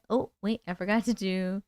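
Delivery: ordinary talk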